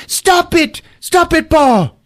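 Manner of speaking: high-pitched hammed-up distress; mock tearful plea. Crying woman begging Paul to stop, mock tearful plea. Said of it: "She's crying".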